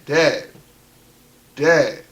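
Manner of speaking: reenacted loud, single-word calls; calling out. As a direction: call into the next room. Calling for dad while walking in.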